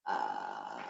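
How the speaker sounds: low creaky fry sound; vocal fry. Shown as a demonstration. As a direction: crackle gently in fry. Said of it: "Just hold it".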